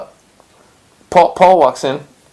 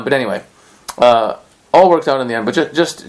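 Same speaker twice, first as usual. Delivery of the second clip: usual speaking voice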